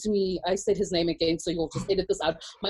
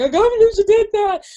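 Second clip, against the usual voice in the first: high, loud disbelieving cry; exasperated outburst; 11 semitones higher, 9 dB louder than usual. Retold or imitated. Venting about mom, a loud disbelieving outburst. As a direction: blurt it out in disbelief. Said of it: "I'm like".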